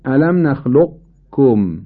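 نخلقکم with a held qaf, no bounce, then kaf; incomplete assimilation. Shown as a demonstration. Teacher showing incomplete idgham, نخلقکم with qaf sounded without echo. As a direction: slowly say نخلقکم as nakhluq-kum, qaf left unreleased. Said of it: "قاف رو بدون صفت قلقله تلفظ کنیم".

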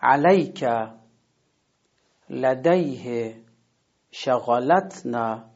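علیک, لدیه and شغلتنا each said slowly with the stress before the sukun; model pronunciation. Shown as a demonstration. Teacher repeating three Quran words slowly as models.

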